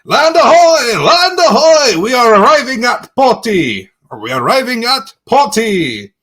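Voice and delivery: high, loud call from the crow's nest; lookout shouting. Acted. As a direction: bellow it down from the mast. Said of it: "hear from the crow's nest".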